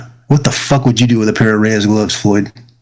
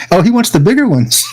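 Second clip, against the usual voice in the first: higher, teasing mimicry; mocking her voice; 6 semitones higher than usual. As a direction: squeak out her line in mockery. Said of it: "She goes".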